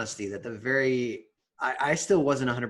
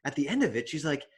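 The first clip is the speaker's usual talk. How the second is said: ordinary talk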